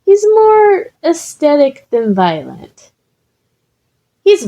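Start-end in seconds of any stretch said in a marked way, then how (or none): 0.0-2.9 high voice. Acted. Izuku voiced high and light.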